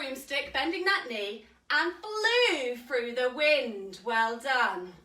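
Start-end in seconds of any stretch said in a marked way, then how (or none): none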